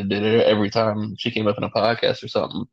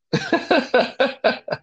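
high, rapid giggling; high-pitched staccato laugh. Real, not acted. Host amused by the circus riff, high giggling.

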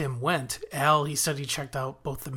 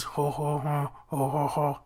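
lightly voiced grunting ho ho ho. Imitating Tim the Toolman's grunt for viewers, lightly done. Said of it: "That's my best Tim the Wo-, Toolman Taylor".

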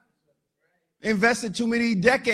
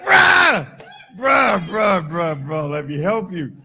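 mock pained yell; 2 semitones lower, 6 dB louder, slower than usual. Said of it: "I'm like".

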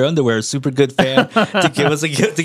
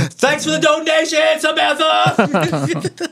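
mock shoutout; 9 semitones higher, slower than usual. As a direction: shout the thanks like a host.

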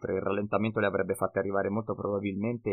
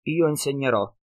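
higher, louder friendly promise; eager promise; 5 semitones higher, 7 dB louder than usual. A narrator's reading. Jacob promising to teach Kurt, eager friendly smile.

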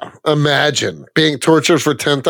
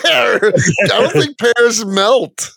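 higher, real laughter through the words; laughing disbelief; 7 semitones higher, slower than usual. Real, not acted. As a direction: ask it while cracking up. Cracking up at friend's melted pear remark.

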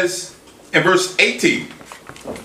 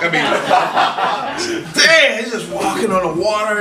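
laughing; 5 semitones higher, slower than usual. Real, not acted. Laughing at Peter's moonwalk.

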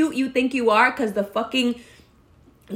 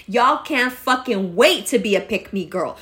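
ordinary delivery

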